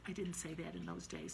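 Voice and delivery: ordinary talk, nothing marked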